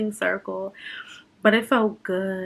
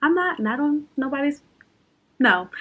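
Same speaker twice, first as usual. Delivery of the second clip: choked-up, breathy words turning high and halting; real tears welling, fighting them back; getting emotional; 5 semitones higher, faster than usual. Real, not acted. Vlogger trying not to cry on camera, getting emotional. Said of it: "am I getting emotional?".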